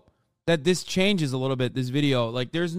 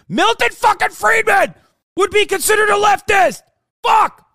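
much higher, much louder yelling; furious shout; 10 dB louder than usual. Real, not acted. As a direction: scream it out in real fury. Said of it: "I've already fucking blown a gasket".

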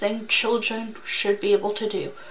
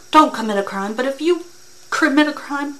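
scolding rant; 5 semitones higher, 6 dB louder than usual. Real, not acted. Reactor lectures the criminal.

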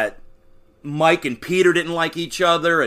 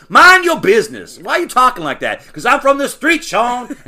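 exaggerated cartoon intonation; 8 semitones higher, 6 dB louder than usual. Acting out Gus's street voice.

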